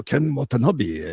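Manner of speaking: slow, drawn-out Japanese actor impression; put-on film actor voice with a growled lead-in; Ken Watanabe. Shown as a demonstration. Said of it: "really cool ones like Ken Watanabe".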